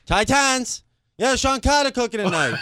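high, put-on caller voice; prank caller.

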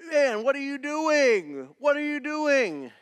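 loud, high, scolding shout; exasperated dad yell. Retold or imitated. Father scolding his son caught at the socket. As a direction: catch the kid red-handed. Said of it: "A-, and, and".

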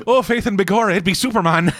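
high, hammed-up stage Irish; mock Irish accent. Teasing the parents' voices, high mock Irish accent. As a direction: exclaim it in a cartoon Irish brogue. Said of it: "Why are his parents Irish?".

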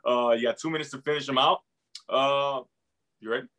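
ordinary talk, nothing marked

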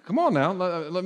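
ordinary talk, nothing marked

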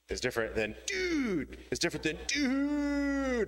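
each dude swung high and drawn out, slowly; varied dude inflections. Shown as a demonstration. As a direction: play dude with big swooping pitch. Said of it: "depends on how you do the inflection".

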